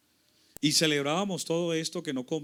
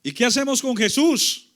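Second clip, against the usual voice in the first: high, loud public question; acted official calling out; calling to crowd; 9 semitones higher, 12 dB louder than usual. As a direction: throw the question out to a whole crowd. Acted authority asking the crowd.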